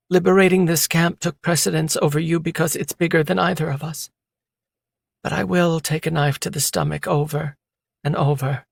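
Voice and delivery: a wounded woman swearing loyalty; pleading woman's voice. A narrator's reading. Female character's long plea.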